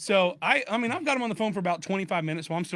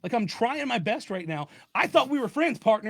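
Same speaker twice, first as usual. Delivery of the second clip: slow, higher-pitched hurt protest; mock frustration; 4 semitones higher than usual. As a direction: plead like a wronged buddy, playing up the frustration. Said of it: "So I'm getting frustrated with him".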